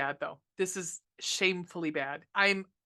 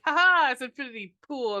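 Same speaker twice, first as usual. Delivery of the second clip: a teasing "ha ha", louder and higher; mock taunt; 5 semitones higher, 7 dB louder than usual. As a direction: gloat playfully, as if tricking the audience. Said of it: "I'll be like".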